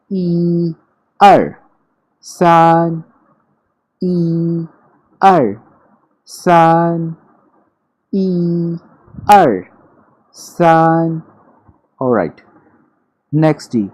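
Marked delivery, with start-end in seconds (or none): 0.1-11.2 high level "ई", sharp loud falling "अर", high level "सान"; Mandarin counting tones. Shown as a demonstration. Teacher counting one to three for students, rhythmic tones. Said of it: "अब तीनों को सुन लीजिए".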